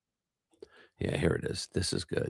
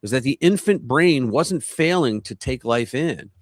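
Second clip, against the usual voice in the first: lively, emphatic presenter's read; article narration; 5 semitones higher, 8 dB louder than usual. Acted. Streamer reading a strange science story to viewers, animated narration. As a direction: narrate it with punch and big swings in pitch.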